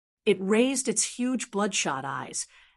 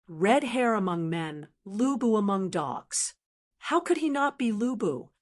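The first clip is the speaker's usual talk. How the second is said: usual speaking voice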